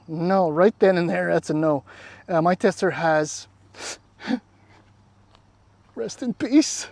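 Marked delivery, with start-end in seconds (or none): 4.3-6.9 laughing. Real, not acted. Laughing about his tester's option.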